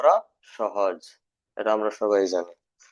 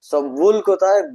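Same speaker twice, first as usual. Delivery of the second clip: higher, louder teacher's question; rhetorical question; 6 semitones higher, 5 dB louder than usual. Shown as a demonstration. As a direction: challenge the class with it. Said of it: "rhetorical question to class". (উঁচু গলায় প্রশ্ন)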